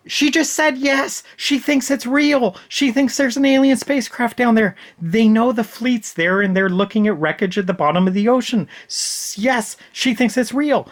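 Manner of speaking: high-pitched, incredulous rant; exasperated. Real, not acted. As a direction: spell out the obvious in sheer frustration. Exasperated rant at dense character.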